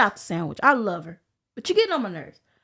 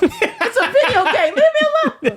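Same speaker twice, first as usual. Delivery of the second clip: laughing; 11 semitones higher, 6 dB louder than usual. Real, not acted. Laughing rant about rival.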